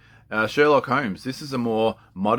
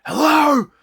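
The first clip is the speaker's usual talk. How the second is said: very high, loud shouted hello; prankster's giant-phone hello; 9 dB louder than usual. Retold or imitated. Prankster answering giant phone, high loud hello. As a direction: bellow it into the huge phone. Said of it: "and he's like".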